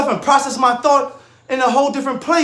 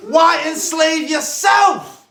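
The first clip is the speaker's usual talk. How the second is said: passionate shout; 7 semitones higher, 5 dB louder, much slower than usual. Real, not acted. Passionate rant to listeners.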